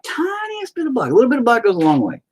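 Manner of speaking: sing-song like a TV painting teacher; lightly voiced cheery art host; mock painting host. Painter tip in high voice.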